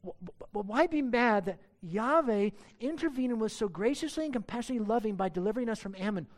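ordinary talk, nothing marked

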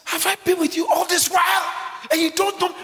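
high, loud, exasperated shout as Jesus; shouted rebuke. Acted. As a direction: cry it out in disbelief to the disciple. Preacher shouting Jesus' words.

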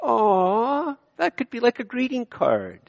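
high, slow, sugary cooing; mock-sweet aww voice; mocking sentimental Canadian. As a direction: coo it like a sappy greeting card. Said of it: "as Canadians, we'd go".

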